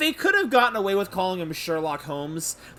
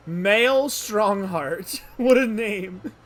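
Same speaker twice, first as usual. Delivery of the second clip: very slow, loud, higher relish; amazed repetition; 4 semitones higher, 6 dB louder, much slower than usual. Real, not acted. Player marvelling at the judge's name, loudly amazed. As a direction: savour the name loudly, drawn right out.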